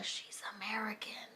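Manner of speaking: whispered aside. Real, not acted. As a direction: whisper the punchline. Whispered side remark.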